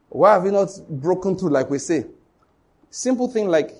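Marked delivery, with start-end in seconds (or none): none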